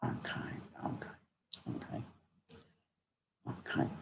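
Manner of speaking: barely audible, spaced-out acknowledgements; murmured aside. Real, not acted. Medium pausing mid-reading to listen, murmured aside. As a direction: murmur to yourself as if listening privately.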